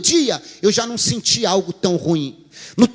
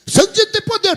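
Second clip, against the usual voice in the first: high, louder outraged objection; raised, incredulous voice; indignant protest; 12 semitones higher, 4 dB louder than usual. Retold or imitated. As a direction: push back at the pastor, offended. Wife protests to the pastor.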